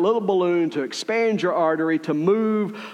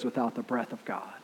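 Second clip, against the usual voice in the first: whispered, lower and softer; 7 semitones lower, 12 dB quieter than usual. Real, not acted. Preacher whispering the key truth to the congregation. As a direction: hush it down to a whisper. Preacher drops to hushed climax.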